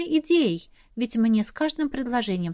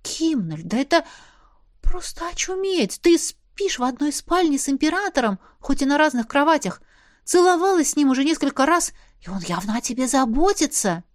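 excited friend; 5 semitones higher than usual. A narrator's reading. Friend excitedly lists facts.

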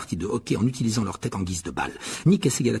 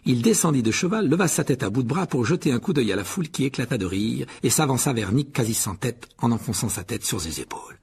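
usual speaking voice

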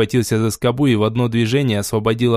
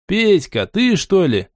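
mock-delighted, pitched up; fake joy; 7 semitones higher, slower than usual. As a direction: put on exaggerated cheer. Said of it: "с показной радостью".

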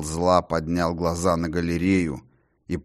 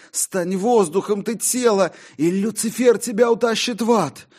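frantic dread; 4 dB louder than usual. Acted. The condemned voice hears the clock strike, terrified. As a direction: dread every stroke of the clock. Damned soul's frantic speech.

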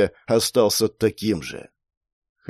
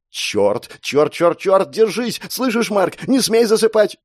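higher, frantic swearing and pleading; panicked urging; 9 semitones higher than usual. Acted. Girl tells dying Mark to hold on, panicked. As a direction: climb in pitch, urgently keeping him awake.

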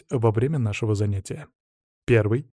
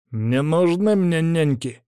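slow, higher-pitched defiant retort; snappish; 6 semitones higher, slower than usual. A narrator's reading. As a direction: snap back, stretching the words in irritation. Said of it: "огрызнулся Данила".

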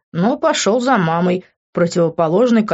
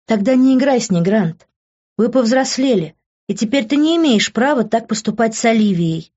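higher, louder motherly scolding; sharp scolding; 6 semitones higher, 4 dB louder than usual. Acted. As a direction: raise your voice at your grown son. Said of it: "прикрикнула она на меня". (мать прикрикивает на сына, громко и выше)

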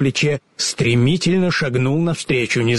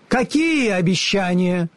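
high, lofty and commanding; grand wizard voice; 7 semitones higher than usual. A narrator's reading. The unseen wizard commands the travellers, high and lofty. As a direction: speak as an all-powerful unseen ruler.

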